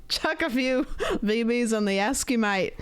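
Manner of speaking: amused, botched Australian impression; bad Australian accent. Streamer copying friend's slang phrase, bad Australian accent. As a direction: have a clumsy go at Australian. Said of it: "That's bad".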